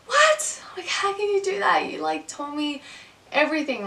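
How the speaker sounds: high, incredulous What; shocked disbelief. Retold or imitated. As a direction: replay the moment the breakup hit her. Retelling her shock at breakup.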